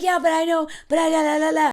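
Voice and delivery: loud, high, babbling over reassurance; mock panicked rambling into la-la-la; frantic protesting. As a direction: imitate yourself insisting anxiously. Frantic over-apologizing to mentor, retold.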